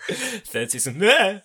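slow, rising, laughing "eh"; mock review, laughing. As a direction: ham up a one-word review.

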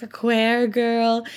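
stretched, drawn-out Irish lilt; a hint of an Irish mother, slow; lightly voiced Irish mom saying a queer girl; slow Irish mom quote. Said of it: "his mom called Layla one time".

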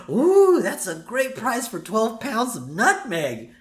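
high, slow gushing shopper voice; mock impressed shopper. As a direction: gush over the bargain. Mock TV shopper admiring price.

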